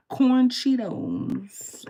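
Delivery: high, loud, very slow reveal; punchline wailed long and high; drawn-out punchline. Real, not acted. Laughing through disgusting punchline.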